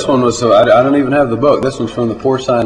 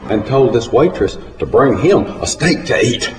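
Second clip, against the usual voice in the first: ordinary delivery, nothing marked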